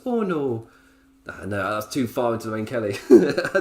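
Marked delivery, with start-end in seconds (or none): 0.1-0.7 a slightly higher mock Scottish wrestler voice; Nikki Cross impression. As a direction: dial the Scottish back toward the wrestler. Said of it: "That's Nikki Cross".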